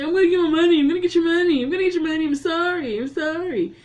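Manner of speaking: high, loud pleading that fades to a whimper; begging bleeding man. Retold or imitated. Bleeding Carl begging for the money, high and pleading. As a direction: plead desperately, then trail off sorry.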